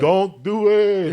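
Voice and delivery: slow, loud, high and dragged-out don't do it; drawn-out warning. Retold or imitated. Cook walks in and gives the inmates a slow warning. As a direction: stretch each word out like a knowing signal. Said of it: "I would go in there".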